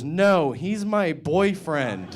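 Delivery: put-on high and drawn out, innocent kid; slow little girl voice. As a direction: stretch it out sweetly like a child. Girl answers driver slowly.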